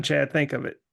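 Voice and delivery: ordinary talk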